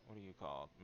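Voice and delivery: normal talking voice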